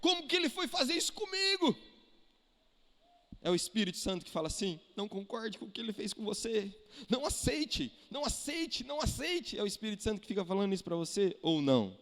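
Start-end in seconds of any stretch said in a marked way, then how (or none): 0.0-1.8 high-pitched indignant complaint; mock outrage, voice pitched up; acted indignation. Acting out an aggrieved, stirred-up believer. 5.0-9.6 mock urging. Ironic voice of Holy Spirit inciting grievance.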